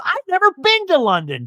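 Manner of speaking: high-pitched thrilled squeal; over the moon. Retold or imitated. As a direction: imitate her giddy with excitement. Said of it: "Jen is, like, over the moon".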